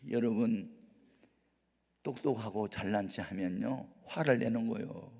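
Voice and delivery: ordinary talk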